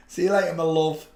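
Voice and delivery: ordinary talk, nothing marked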